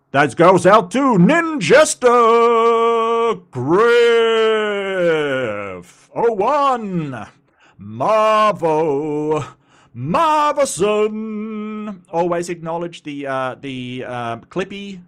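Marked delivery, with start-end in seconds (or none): 0.1-12.0 loud, high, drawn-out hype announcer. Pretend. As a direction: boom the names out like a ring announcer. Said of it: "I do an announcer voice".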